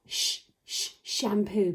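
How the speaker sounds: "Sh-, sh-" as hushed onsets before "shampoo"; sounding out sh. Shown as a demonstration. Teacher repeating for children to join, "Sh-, sh-, shampoo" sounded out. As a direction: split off the "Sh-" twice, then say "shampoo". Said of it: "Can you say it with me?".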